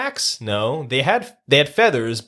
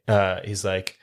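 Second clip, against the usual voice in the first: ordinary delivery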